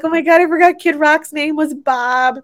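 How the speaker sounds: like it just clicked; sudden realization. Retold or imitated. Realizing [name]'s real name while watching, amazed. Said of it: "I'm like".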